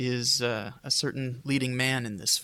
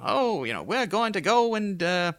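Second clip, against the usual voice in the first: higher, louder Transatlantic radio-announcer voice; hammed-up old-timey Transatlantic patter; old-time transatlantic announcer accent; 4 semitones higher, 5 dB louder than usual. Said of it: "it's that type of a voice".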